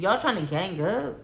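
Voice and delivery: ordinary talk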